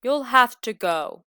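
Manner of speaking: loud stern order; stern wolf. Acted. Wolf orders the lamb out, loud. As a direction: send her off firmly, loud.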